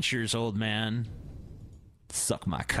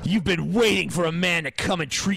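macho shouting. Pretend. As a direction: yell the ad like a sleazy tough guy. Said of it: "This is how you fucking talk to a woman".

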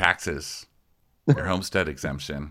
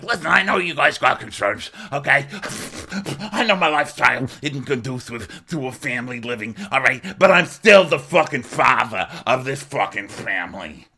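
Frank; 6 semitones higher than usual. Retold or imitated. Frank answering Dolores about house rules, high indignant impression. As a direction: protest your standing, pitched well up. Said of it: "And he's like".

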